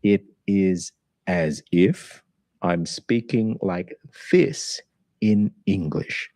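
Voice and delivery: slow, every syllable carefully spelled out; over-enunciated. Shown as a demonstration. Teacher mimics a learner who over-enunciates, slowly. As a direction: speak overly careful and deliberate. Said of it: "over-enunciated".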